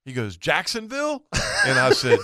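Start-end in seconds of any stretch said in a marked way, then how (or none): 0.4-1.2 high-pitched disbelieving echo; astonished question. Retold or imitated. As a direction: imitate the boater's shock, pitched way up. Boater astonished at where he is.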